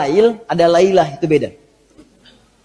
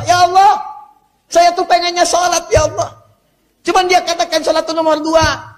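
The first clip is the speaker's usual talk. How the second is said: pleading sinner; 7 semitones higher than usual. Acted. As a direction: beg God as a sinner blaming satan. Follower pleading to God on Judgment Day.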